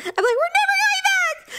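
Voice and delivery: high, drawn-out horrified vow; mock alarm. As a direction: pitch it way up in playful dread. Retells vow after ghost theory.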